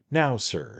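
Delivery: slow, high, ingratiating lawyer; a hint of a fussy little attorney; little attorney, confiding. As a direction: fuss over him, dear sir. The attorney talks in the story.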